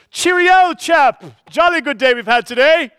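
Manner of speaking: high, loud hammed-up posh British English; posh British accent. Stranger in the church hall greets you, loud hammed-up British accent. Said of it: "The Galileans had a distinctive accent".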